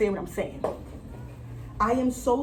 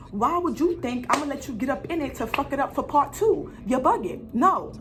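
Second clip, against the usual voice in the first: ordinary talk, nothing marked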